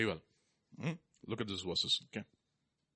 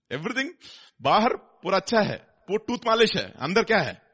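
loud preaching; 7 semitones higher, 7 dB louder, much faster than usual. Real, not acted. Switches to Hindi, preaching loud.

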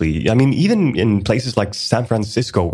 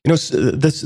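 ordinary talk, nothing marked

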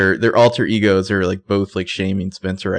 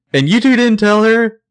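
high, loud indignant accusation; accusing cry; 12 semitones higher, 6 dB louder than usual. Retold or imitated. Spencer turns on Hannah and Emily, high indignant accusation. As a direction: throw it at them, outraged.

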